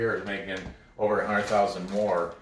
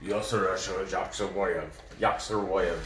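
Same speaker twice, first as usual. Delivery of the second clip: mock Russian accent, lower and softer on "Yasser Yaksherboyev"; lightly put-on Russian accent; 4 semitones lower, 4 dB quieter than usual. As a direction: try the name in a half-hearted Russian accent. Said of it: "Maybe if I say it with a Russian accent".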